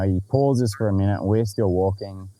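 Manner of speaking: ordinary talk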